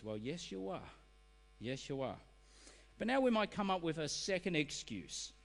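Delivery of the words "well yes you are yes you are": soft, understated reply; quiet verdict. Acted. God replying to an excuse on Judgment Day, quiet verdict. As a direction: deliver the answer softly, without pushing.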